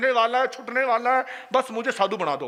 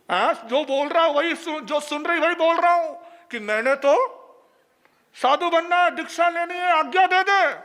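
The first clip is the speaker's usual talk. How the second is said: loud demand; 6 semitones higher, 3 dB louder than usual. Retold or imitated. Husband shouting his demand at wife.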